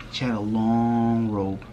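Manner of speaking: "long" held for extra length; drawn-out LONG. Retold or imitated. Recapping the lady's robe, "long" drawn out. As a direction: stretch out the word "long". Repeats the robe's length, stretched.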